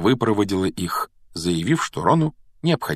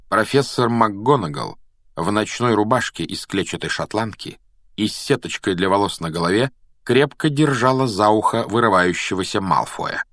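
usual speaking voice

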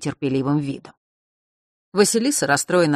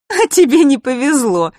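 laughing retort; 10 semitones higher, 8 dB louder than usual. A narrator's reading. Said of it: "хохотнула Василиса".

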